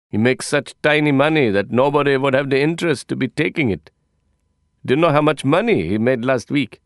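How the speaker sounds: put-on teasing accented foreign man; lightly accented, teasing tone; Persian accent. Abdullah mocks the tiny money.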